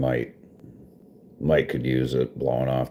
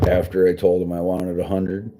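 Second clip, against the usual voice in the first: ordinary talk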